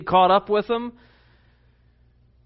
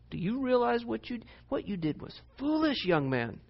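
put-on Italian accent; 6 semitones higher, 7 dB quieter than usual. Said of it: "with a, an Italian accent".

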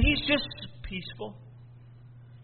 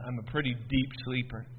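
ordinary delivery

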